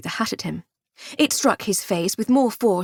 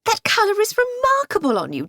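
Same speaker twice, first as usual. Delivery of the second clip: very high, sugary false praise; catty compliment; 12 semitones higher than usual. A narrator's reading. Dahlia needling her rival, high and sugary. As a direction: purr a backhanded compliment. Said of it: "Dahlia said".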